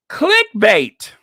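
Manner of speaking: loud high scoffing shout. Real, not acted. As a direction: yell it out in scorn. Mocking a tabloid headline.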